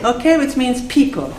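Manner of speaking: normal talking voice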